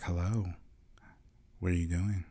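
low, soft hotline voice; sultry phone voice. Pretend. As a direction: purr it like a phone sex caller. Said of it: "like the sex callers".

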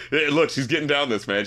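ordinary talk

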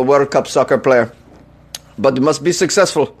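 ordinary delivery

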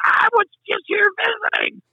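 mock-panicked squeal, voice shooting way up; hammed-up helpless objection in a very high voice; high squeaky protest. Visitor to track boss after being made announcer, squeaky mock-panicked protest.

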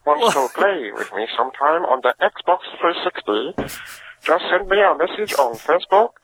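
cartoon European villain accent, hammed up; generic European villain accent. Caller talking soccer games to the podcast, European villain accent. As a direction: put on a vague Transylvanian-French accent. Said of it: "generic Hanna-Barbera European villain".

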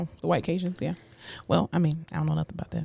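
hushed and cautious; drops the voice low; quiet. Real, not acted. Touchy race topic.

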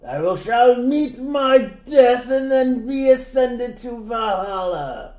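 high, loud, slow warrior proclamation; epic viking voice. Pretend. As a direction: declaim it like a doomed Norse hero. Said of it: "we're just doing Skyrim".

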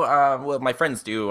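normal talking voice

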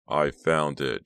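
plain reading voice

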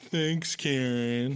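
slow, drawn-out mock thanks; sarcastic jeer. Man imitating passengers blaming his girlfriend, sarcastic. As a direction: mimic a snide heckler, stretching it out.

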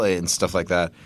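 ordinary talk

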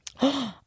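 excited gasp. Acted. Excited gasp after greeting.

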